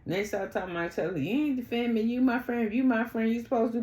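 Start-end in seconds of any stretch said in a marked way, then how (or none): 1.2-3.8 higher-pitched whine of complaint, retold; mimicked complaint. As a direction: imitate an aggrieved friend, voice pitched up. Acting out a friend's complaint.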